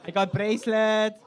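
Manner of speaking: lightly voiced vendor; mock Thai vendor. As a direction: list the goods eagerly.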